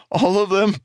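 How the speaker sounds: stunned cry for his lost men; anguished cry. A narrator's reading. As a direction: cry it out in horror. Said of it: "Jarek cried".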